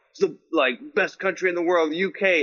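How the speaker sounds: lightly mocking British accent. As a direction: tease in a hammy British accent. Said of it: "They say it in an accent".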